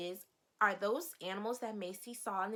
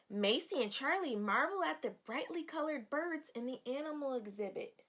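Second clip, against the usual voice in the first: put-on bright read-aloud voice for children; storybook reading. Teacher reading the storybook to her young students. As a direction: read the page like story time.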